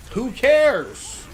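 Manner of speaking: high, loud who cares; scornful yell. Acted. Dismissing the bragging teammate with a high, loud jeer. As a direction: throw it back with scorn.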